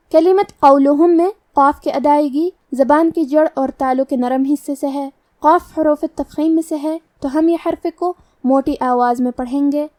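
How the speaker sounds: usual speaking voice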